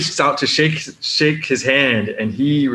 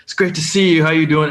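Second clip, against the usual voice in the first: higher-pitched, eager welcome; real excited greeting; 6 semitones higher than usual. Retold or imitated. Actor greeting a fan at his booth, real excited. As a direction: welcome them eagerly, voice lifted. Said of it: "he just was real excited".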